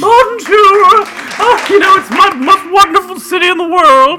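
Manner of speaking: put-on high, fluttery old lady voice; an old lady gushing at her husband; fussy old lady. Old lady greeting the hosts in Paris, high and fluttery.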